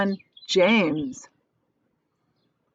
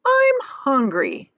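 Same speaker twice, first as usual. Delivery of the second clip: higher, louder, slow and drawn out; spider announcing; 7 semitones higher, 4 dB louder, much slower than usual. Acted. Spider announcing her hunger to James, high and slow. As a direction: savour the hunger. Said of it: "the spider announced suddenly".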